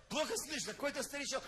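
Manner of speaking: ordinary talk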